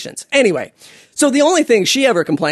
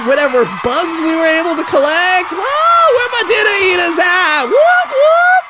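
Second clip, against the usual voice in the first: crazy stand-up comic; 9 semitones higher than usual. Pretend. Nanny as a stand-up comic hyping the audience, high and shouting. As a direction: whoop it up like a wild comic working the crowd. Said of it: "like one of those crazy stand-up comics".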